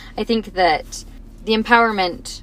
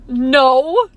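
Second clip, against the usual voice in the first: put-on louder, higher little-boy no; child's answer; 5 semitones higher, 6 dB louder than usual. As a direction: blurt it like the kid. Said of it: "He was like".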